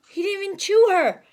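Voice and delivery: ordinary delivery, nothing marked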